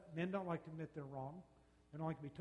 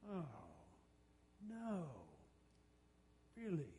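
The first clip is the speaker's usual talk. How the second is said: mock counselor listening; 7 dB quieter, much slower than usual. Shown as a demonstration. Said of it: "most of the counseling sessions are like this".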